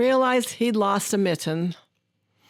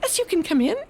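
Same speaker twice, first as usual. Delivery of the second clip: higher, kindly mouse answer; 7 semitones higher, faster than usual. Acted. Mouse lets the fox into the mitten, higher. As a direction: squeak out a welcome. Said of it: "said the mouse".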